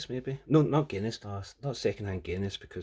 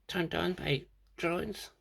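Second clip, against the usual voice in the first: mocking girlfriend; 9 semitones higher, 4 dB quieter than usual. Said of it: "she was like".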